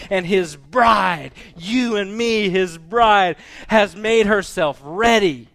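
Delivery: high, loud, sustained proclamation; crying out. Acted. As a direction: shout praise like a mighty multitude. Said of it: "crying out".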